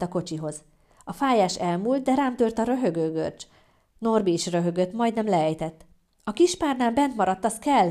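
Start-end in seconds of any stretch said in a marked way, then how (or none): 6.2-7.9 high, loud cry; shrieking. Acted. Labouring woman demanding her forgotten pillow, shrieking. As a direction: squeal it urgently. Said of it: "visítottam".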